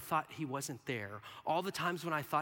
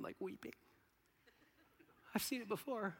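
usual speaking voice

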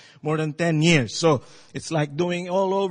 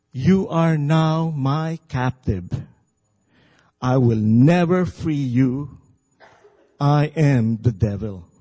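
sinister devil voice; 5 semitones lower than usual. A narrator's reading. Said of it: "a sinister voice saying".

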